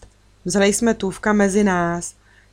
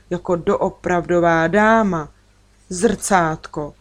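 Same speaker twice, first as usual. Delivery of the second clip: ordinary delivery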